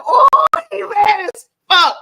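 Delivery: loud, high, drawn-out whoop; amused exclamation. Retold or imitated. Telling the co-host how mad they seemed, amused exclamation. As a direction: cry it out high and slow, hugely amused. Laughing retelling of their reaction.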